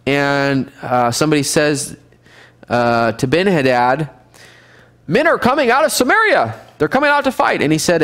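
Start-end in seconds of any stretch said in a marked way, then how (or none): 5.1-7.6 alarmed report. Acted. Messenger reports an attack.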